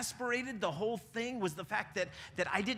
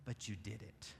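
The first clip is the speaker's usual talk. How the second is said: low, much softer accusation; quiet hurt reply; 12 semitones lower, 11 dB quieter than usual. Retold or imitated. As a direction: say it softly, wounded.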